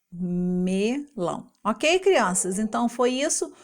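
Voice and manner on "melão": MELÃO very slowly, a long ME then LÃO; syllable by syllable. Shown as a demonstration. Teacher revealing fruit with that ending, slow me-lão for MELÃO. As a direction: pull ME and LÃO apart in MELÃO. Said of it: "O que que termina desse jeito com lão".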